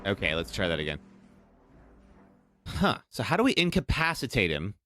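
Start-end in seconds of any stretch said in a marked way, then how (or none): none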